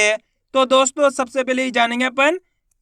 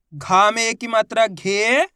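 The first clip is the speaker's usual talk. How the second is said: slow; slower than usual. Shown as a demonstration. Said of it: "चलिए देखिए".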